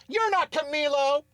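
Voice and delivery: high-pitched indignant reaction; mock protest. Host acting out his reaction to a new player, mock protest. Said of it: "my reaction is".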